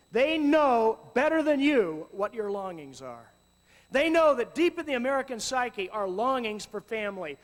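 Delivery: impassioned preacher; full-force preaching. Acted. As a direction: drive the point home to the crowd.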